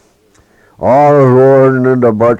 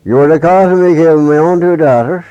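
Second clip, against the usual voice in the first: raised, higher angry witch voice; old witch scolding Peg, raised and angry; angry accusation; 7 semitones higher, 4 dB louder than usual. Acted. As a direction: hurl the blame at her, voice raised.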